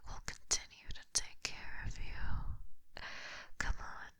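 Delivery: intimate whisper right at the ear; close breathy whisper. Acted. Snake captor taunting the prince up close, breathy whisper. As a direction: lean in and breathe the words.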